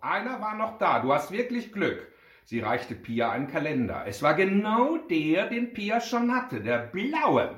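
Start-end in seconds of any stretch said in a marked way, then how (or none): 0.0-2.1 high, upbeat saleswoman; excited, higher voice; delighted announcement. A narrator's reading. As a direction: share the good news, pitched up. Assistant presents the last calendar.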